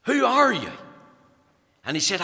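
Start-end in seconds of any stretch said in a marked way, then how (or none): 0.1-0.7 higher, louder call across the crowd. Retold or imitated. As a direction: shout the question out over the tent. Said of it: "D L Moody asked him".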